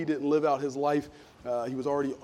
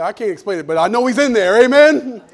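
louder and higher, building to a shout; preaching at full force; 8 semitones higher, 16 dB louder than usual. Acted. The pastor rousing his church, building to a shout. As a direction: drive it home to the congregation.

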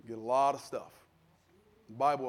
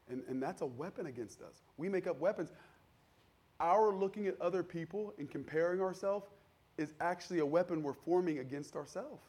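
ordinary delivery

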